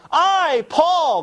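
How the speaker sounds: "I" and "Paul" each hit high and punched; emphasis on "I, Paul". Shown as a demonstration. Someone reading Paul's letter aloud, emphatic on "I, Paul". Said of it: "there's an emphasis there".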